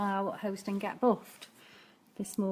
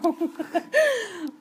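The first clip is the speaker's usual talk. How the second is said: giggle; 9 semitones higher than usual. Real, not acted. Laughing about many viewers.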